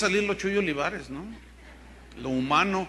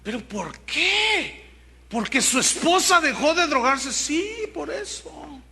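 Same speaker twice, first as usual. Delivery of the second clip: mock disbelief; 7 semitones higher than usual. Preacher acts out incredulous exchange.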